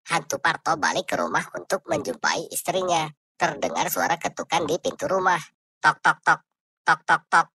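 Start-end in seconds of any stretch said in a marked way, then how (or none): 5.8-7.5 knocking. Acted. Knocking sound imitated.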